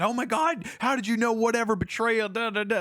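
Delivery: high, shocked and frantic; panicked gasp. Retold or imitated. Amir, shocked his father knew, high and panicked. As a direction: gasp it out as if caught. Amir's panicked reaction retold.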